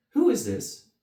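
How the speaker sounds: loud, high imperious demand; demanding queen. Acted. The Queen of Hearts demands, loud imperious. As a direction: bark it as the queen. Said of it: "she demanded to know".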